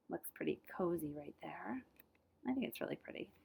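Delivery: soft whispered aside; cozy whisper. Real, not acted. Reader to listeners about the cozy picture, whispered. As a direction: whisper it snugly.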